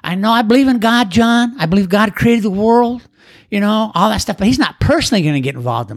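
dismissive skeptic. Retold or imitated. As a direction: play the guy who shrugs God off as if it's obvious. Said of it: "And so the guys would say".

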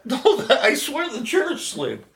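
high, laughing fake fright; playing innocent about the moving chair; mock alarmed. Dad pretends the chair moved by itself, laughing and mock alarmed.

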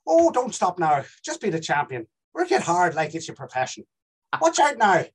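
lilting Southern Irish accent. Shown as a demonstration. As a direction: perform it in a southern Irish brogue. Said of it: "Irish Southern".

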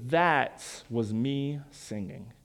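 low, soft and slow, quietly wounded; understated tearful hurt. Retold or imitated. Mother in tears answers her teasing son, understated hurt. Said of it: "there are tears running down her face".

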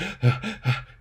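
imitated panicky gasps; panic breathing. Patient showing wife-witnessed episode, panicked breathing. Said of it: "like, panic breathing".

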